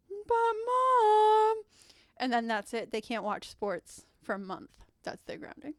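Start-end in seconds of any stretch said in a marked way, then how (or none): 0.1-1.6 high, loud, drawn-out whine; whining sons. Acted. Grown men protest being grounded by mom, whining. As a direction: whine like kids protesting a punishment. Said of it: "They're all like".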